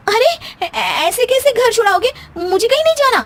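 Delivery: high alarmed protest against being sent away; shocked protest. Acted. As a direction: caught off guard, refuse at once. Husband says he'll send her home.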